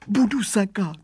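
high, thin whine; whimpering voice. A narrator's reading. Caught youngster promises the father, whimpering. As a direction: whimper it like a scared culprit. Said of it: "sliboval výrostek kňučivým hlasem".

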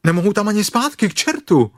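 fast, high, loud complaint; alarmed exclamation. Acted. Stuck bear finds no way back, high frustrated cry. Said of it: "zvolal Pů".

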